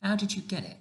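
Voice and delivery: put-on light girlish question; Sophie's voice. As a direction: ask it as a wide-eyed young girl. Narrator voices young Sophie.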